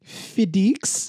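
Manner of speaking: FedEx said as Fee-diks with a rise; playful accented FedEx; mock Russian accent. Shown as a demonstration. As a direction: copy the Russian-style FedEx. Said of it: "what do we call it".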